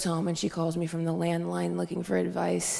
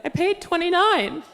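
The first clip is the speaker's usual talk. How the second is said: indignant mom; 9 semitones higher, 4 dB louder than usual. Retold or imitated. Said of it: "And Mom's like".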